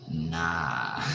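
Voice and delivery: NAH held long and unhurried; drawn-out nah. Shown as a demonstration. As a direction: stretch NAH out slowly, letting it fall. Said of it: "I don't say it like that. I say".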